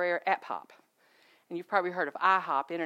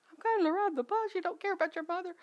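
high, put-on wounded voice, faster at the end; mock indignation; 11 semitones higher, faster than usual. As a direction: play the hurt mother, half joking. Mock protest to Kobe about bus.

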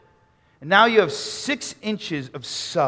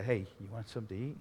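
quick, low, hushed question; timid offer; 5 semitones lower, 13 dB quieter, much faster than usual. Acted. Nathaniel to the next guy in the crowd, timid and quiet. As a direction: ask meekly, hurrying it out softly.

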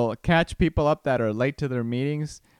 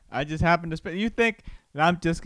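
ordinary talk, nothing marked

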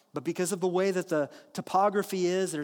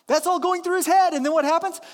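excited; 9 semitones higher, 9 dB louder than usual. Real, not acted. Laughing excited storytelling peak.

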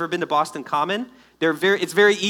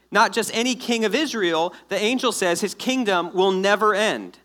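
usual speaking voice

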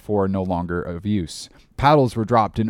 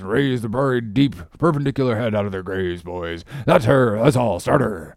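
Stubb urging his crew; 3 semitones higher than usual. A narrator's reading. Stubb to his oarsmen in the chase, urgent cheering on. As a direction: coax and cry the rowers on.

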